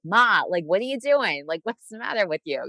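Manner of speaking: high, loud "Ma" and swooping questions; exasperated daughter. Retold or imitated. Italian-American daughter to her mom, exasperated. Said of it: "when I talk about my mom".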